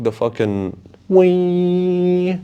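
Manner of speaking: high, slow stretched screech of a wine glass; mock screech; imitated squeak. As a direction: draw out a thin glass squeal. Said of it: "it was loud as fuck".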